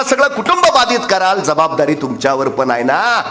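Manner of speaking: high-pitched, urgent remonstrating; heated scolding. Acted. Speaker berates lockdown breakers over family responsibility, heated scolding.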